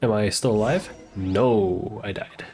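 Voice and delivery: ordinary delivery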